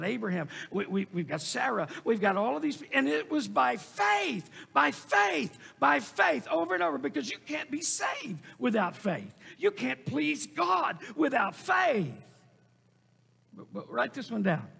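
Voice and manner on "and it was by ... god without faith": raised, insistent refrain landing on each "faith"; full-force preaching. Real, not acted. Preacher telling congregation faith is essential, full-force preaching. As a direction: drive it home over and over.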